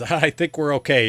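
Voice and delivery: lightly voiced rider after a getaway; horse rider. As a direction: call back once the danger has passed. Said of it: "He says".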